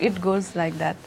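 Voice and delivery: ordinary talk, nothing marked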